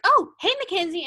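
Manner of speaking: high, loud, bright call of a name; excited greeting. Retold or imitated. Teammates spotting their old captain on the sidelines, calling out excitedly. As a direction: call out gleefully like a teen spotting a friend. Girls greeting her at a game.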